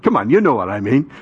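ordinary talk, nothing marked

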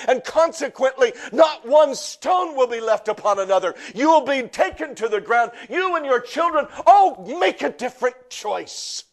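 high, loud preaching that swells into shouts; preaching at full force. Acted. Pastor preaching to the congregation, full force. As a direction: preach it hard to the crowd, building.

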